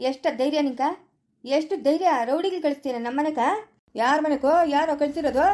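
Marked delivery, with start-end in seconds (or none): none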